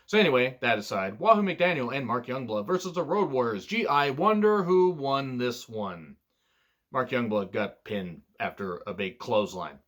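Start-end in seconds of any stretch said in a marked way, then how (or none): 3.7-6.2 slow, higher-pitched mock curiosity; sarcastic wondering. Wrestling fan mocking a predictable match result, sarcastic. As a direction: draw it out with fake wonder.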